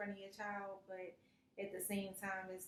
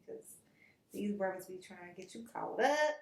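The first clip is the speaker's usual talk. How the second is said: usual speaking voice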